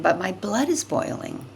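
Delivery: usual speaking voice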